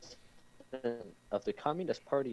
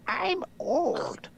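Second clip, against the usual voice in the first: high, loud mock queen voice; old queen; 7 dB louder than usual. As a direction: pitch it way up as the queen. The Queen's line in story.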